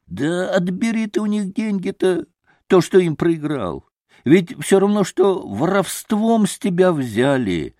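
high, insistent appeal; high-pitched exclaiming and pleading; Трифон Борисович. A narrator's reading. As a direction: urge him as an indignant innkeeper. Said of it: "возгласил Трифон Борисович".

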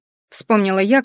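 usual speaking voice

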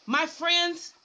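ordinary talk, nothing marked

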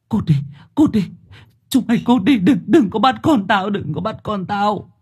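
high, frantic pleading cry; panicked screaming. Acted. Mother-to-be drives ghosts away from her baby, screaming in panic. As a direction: beg in panic, voice pitched high. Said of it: "kêu gào".

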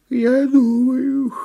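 high, very drawn-out yawn; words stretched into a turtle yawn. A narrator's reading. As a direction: speak through a big yawn. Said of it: "зевнула черепаха".

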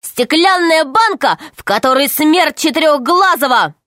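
shouting. A narrator's reading. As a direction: announce it to the crowd. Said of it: "кричал он".

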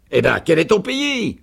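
loud, high, pressing question; impatient sergeant. A narrator's reading. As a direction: push for the answer, voice climbing. Sergeant presses for her country. (sergent pressant, question forte et aiguë)

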